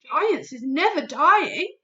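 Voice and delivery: loud giant voice, firm; a big giant setting her straight; BFG, friendly giant. Acted. BFG voice in story.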